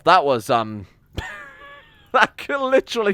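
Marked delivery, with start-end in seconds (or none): 1.2-3.1 laugh-cry in despair. Real, not acted. Streamer reacts to a botched run, laughing wail.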